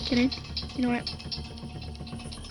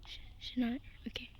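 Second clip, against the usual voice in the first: soft unvoiced whisper; relieved whisper; 7 semitones lower, 20 dB quieter, faster than usual. Real, not acted. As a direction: whisper it, still hiding. Hiding from Granny.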